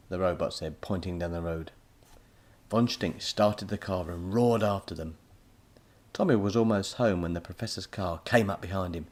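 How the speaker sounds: ordinary delivery, nothing marked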